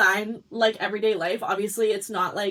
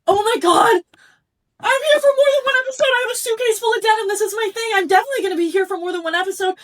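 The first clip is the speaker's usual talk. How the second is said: high, loud, racing burst of glee; ecstatic excitement; 10 semitones higher, 7 dB louder, faster than usual. Retold or imitated. Designer reliving opening a suitcase full of denim, ecstatic. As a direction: squeal the realization out in a fast rush.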